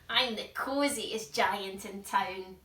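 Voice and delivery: loud contented cry; a cosy giant crowing; happy cry. Acted. George cries out in his gown, loud and happy. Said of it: "he cried".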